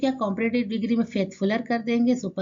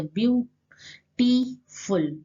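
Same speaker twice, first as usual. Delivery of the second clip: "Beautiful" very slowly, in three separate beats; view, टी, फुल with clear gaps; teacher counting out the syllables of "beautiful"; split into syllables; much slower than usual. Shown as a demonstration. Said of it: "तीन syllable का use किया गया".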